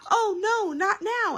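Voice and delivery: very high, loud panicked groan; dismayed. A narrator's reading. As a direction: wail it in exaggerated dismay. Daddy dismayed by police siren.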